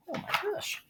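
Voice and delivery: usual speaking voice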